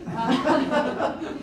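light giggle; self-conscious laugh. Real, not acted. Laughs at being lost.

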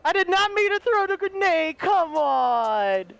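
high, slow desperate plea; panicked pleading. Real, not acted. Gamer pleading with the angry creature after a grenade, high and desperate. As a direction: beg the monster not to be mad.

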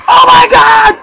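very high, loud shrieking excitement; frenzied yelling. Real, not acted. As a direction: scream as everything goes crazy. Frenzied yelling during game chaos.

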